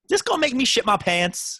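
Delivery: coarse laughing contrast to the polite version; blunt crude version. Retold or imitated. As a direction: blurt it out crudely through a laugh.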